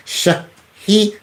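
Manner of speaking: "Shahir" slowly, split as sha-hee-r; slow sounding out. Shown as a demonstration. Host trying first name "Shahir", slow sounding out. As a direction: draw out each syllable of "Shahir". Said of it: "that's the first name, I think".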